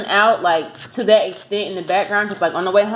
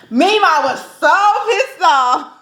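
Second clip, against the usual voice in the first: laughing; 8 semitones higher, 4 dB louder than usual. Real, not acted. Laughing while recounting her anger.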